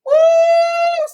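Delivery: high sung ooh at the top of her voice; high sung exclamation breaking her rant. Real, not acted. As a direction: let out a high singing ooh. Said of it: "I don't know why I'm getting a little heated".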